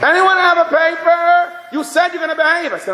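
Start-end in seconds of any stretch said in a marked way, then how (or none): 0.0-1.6 high, drawn-out mock holler; hammed-up classroom yell. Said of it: "he yells". 1.7-2.7 high-pitched mock outrage; acted exasperated scolding; screaming. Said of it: "He starts screaming at him".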